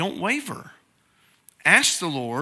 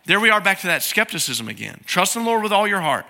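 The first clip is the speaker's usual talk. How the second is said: usual speaking voice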